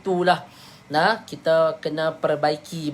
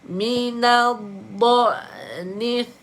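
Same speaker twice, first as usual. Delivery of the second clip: "du'nith" stretched slowly; slow Quran recitation; 5 semitones higher, much slower than usual. Shown as a demonstration. Teacher reciting the verse for students.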